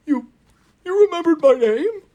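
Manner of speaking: high, amazed, tearful question; a neglected engine finally noticed; touched surprise. Acted. Booster amazed.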